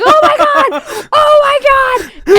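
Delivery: repeated high, loud cries imitating brother; sudden screamed outburst, as if about to crash; excited shout. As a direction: yell it with alarming excitement. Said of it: "and he's like".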